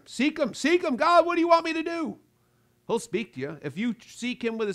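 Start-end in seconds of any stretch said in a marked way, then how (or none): 0.0-0.9 rising urgent plea. Acted. Repeated exhortation climbing. 0.9-2.2 higher, louder earnest plea; earnest cry to God. Pretend. Voicing a seeking believer.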